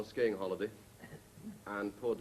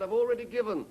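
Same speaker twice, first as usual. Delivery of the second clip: high, loud reply over distance; calling back; 9 semitones higher, 7 dB louder than usual. Acted. Skier answering the Red Cross, high loud call. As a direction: holler the refusal back down.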